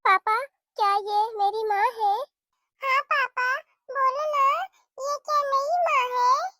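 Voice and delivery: put-on lightly high child voice asking; children ask papa if she is their new mother, lightly childlike; curious little child. Child asks about mother.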